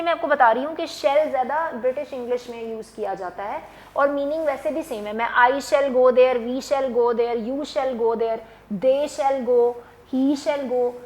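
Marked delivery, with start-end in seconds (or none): 5.3-10.9 slow, louder model sentences; slow example drill. Shown as a demonstration. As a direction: spell out each shall sentence slowly for the class. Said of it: "आप shall लगा दें तो कोई फ़र्क नहीं पड़ेगा". (धीमे, ज़ोर से उदाहरण वाक्य)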